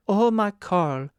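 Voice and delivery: a touch of the cook's clinging passion; crying out. A narrator's reading. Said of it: "she cried".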